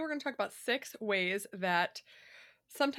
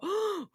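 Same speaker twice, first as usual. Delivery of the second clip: gasp; 13 semitones higher, 4 dB louder than usual. Retold or imitated. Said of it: "my kids will walk out and go".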